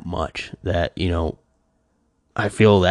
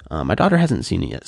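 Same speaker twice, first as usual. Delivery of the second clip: ordinary talk, nothing marked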